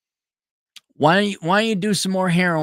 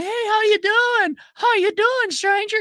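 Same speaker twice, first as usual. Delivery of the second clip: put-on chirpy ex-girlfriend; 3 dB louder than usual. The ex greeting him years later, very high and sweet. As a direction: coo it like a bubbly old flame.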